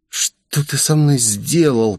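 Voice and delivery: drawn-out accusing hiss. A narrator's reading. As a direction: hiss it slowly, with suspicion. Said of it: "прошипела она".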